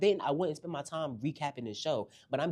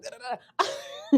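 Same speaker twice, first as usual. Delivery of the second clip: high, slow laughing "da, da, da"; 10 dB louder, slower than usual. Real, not acted. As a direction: break up laughing. Laughing after the quote.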